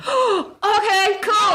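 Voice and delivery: high, slow, thrilled okay; excited. Retold or imitated. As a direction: act cool but sound thrilled. Hears Cranky Bear is booked.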